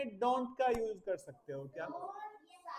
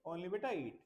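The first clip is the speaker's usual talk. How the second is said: ordinary talk, nothing marked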